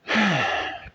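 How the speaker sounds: heavy exhaled sigh; regretful sigh. Real, not acted.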